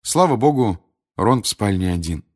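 plain reading voice

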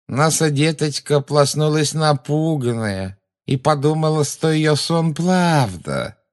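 high, nasal, taunting imitation of a child; mocking baby talk. A narrator's reading. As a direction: whine it high like a toddler, drawing out the end. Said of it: "жутко прогнусавила женщина, издевательски подражая детскому выговору". (издевательски гнусавя по-детски)

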